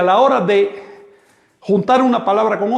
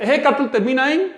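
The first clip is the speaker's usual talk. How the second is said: ordinary talk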